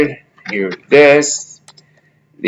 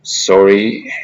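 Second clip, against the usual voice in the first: ordinary talk, nothing marked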